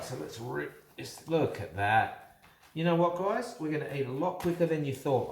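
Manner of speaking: ordinary talk, nothing marked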